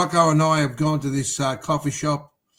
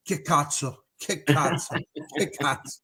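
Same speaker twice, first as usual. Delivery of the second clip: Italian cursing; 5 semitones lower, 7 dB quieter than usual. Retold or imitated. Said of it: "all you can hear is".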